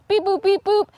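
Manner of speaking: high-pitched spoken dial tones; phone beeps. Pretend. Reporter shows viewers how to text, playful high beeps. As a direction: playfully imitate keypad beeps.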